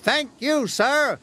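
high, pleased character voice; eager engine. A narrator's reading. James thanks Sir Topham Hatt, high and eager. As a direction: thank him keenly, high up.

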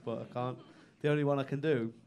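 ordinary delivery

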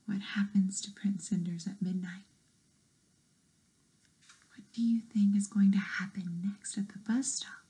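soft unvoiced whispered question; whispering. Asks listeners in a whisper.

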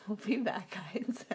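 hushed whisper. Real, not acted. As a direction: whisper it to the viewers. Whispered sign-off before cut.

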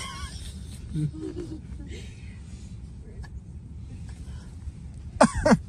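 wheezing silent laugh; high breathy laugh. Real, not acted. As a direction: laugh without voice. Laughing at backwards talk.

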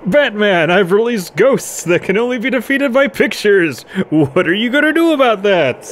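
high, loud taunting supervillain; Joker. Acted. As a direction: taunt your nemesis in a high cartoon-villain voice. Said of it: "That's my Joker voice".

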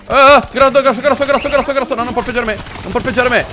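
high, rattled gamer yelp; comic panic. Real, not acted. Player grabbed by a big enemy, comic panic. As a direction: fend off a big monster, frantically.

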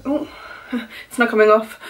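normal talking voice

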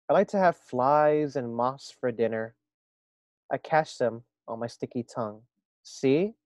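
lively storybook reading voice; reading to children. A narrator's reading. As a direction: read it aloud to a class. Reads story aloud to first graders.

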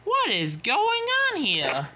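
high, urgent demand; astonished cry. Acted. Emily asks the fleeing engines, crying out astonished. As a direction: exclaim it high, in alarm. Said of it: "cried Emily".

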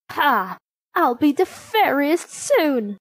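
triumphant wicked queen, rising in pitch; gloating villain. A narrator's reading. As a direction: crow over your helpless victim. Disguised queen gloats over victim.

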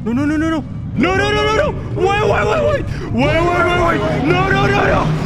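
high, loud frantic pleading; rapid-fire repeated cries in panic; panicked. Real, not acted. Panics as danger approaches.

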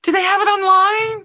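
high-pitched, eager question; eager excitement. Real, not acted. Shopper asking if she can order it online, excited. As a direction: ask it with real excitement, pitch up.

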